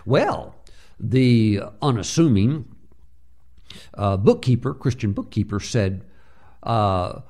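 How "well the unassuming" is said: ordinary delivery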